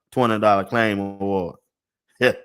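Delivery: ordinary talk, nothing marked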